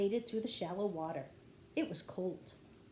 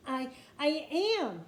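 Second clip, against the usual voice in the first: higher, louder, stammering gasp; 6 semitones higher, 6 dB louder than usual. A narrator's reading. Annie on the rope answers Jack, gasping and straining. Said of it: "gasped Annie".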